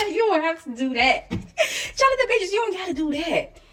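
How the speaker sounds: calls his name out high, through a laugh; laughing scold. Real, not acted. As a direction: tease him while laughing. Laughing while scolding Jonathan Majors.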